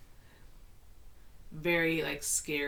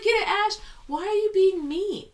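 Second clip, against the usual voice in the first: very high, loud shrieking disbelief at the screen; retold fan outrage; 10 semitones higher, 7 dB louder than usual. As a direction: yell at the screen like a betrayed fan. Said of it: "I was like".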